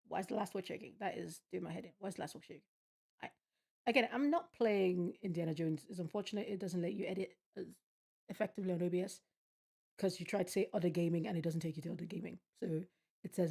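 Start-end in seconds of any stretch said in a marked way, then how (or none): none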